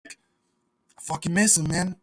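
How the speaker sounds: slow, soft, mock-mournful sigh of a line; quiet grief. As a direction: let it drop, slow and soft, like missing a dog. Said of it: "I would just be like".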